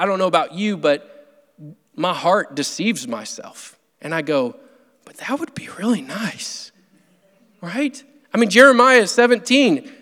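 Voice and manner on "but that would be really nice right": wishful inner voice. Pretend. Sermon, voicing his deceitful heart.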